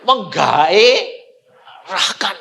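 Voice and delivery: very slow, stretched word; drawn-out savouring. Acted. Preacher recalls the film's allure, very slow and stretched. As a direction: linger on every syllable.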